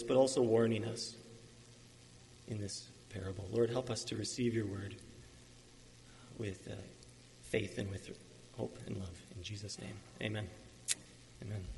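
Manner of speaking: low, soft prayer voice. Real, not acted. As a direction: pray softly and low, trailing to a hushed amen. Leads the opening prayer.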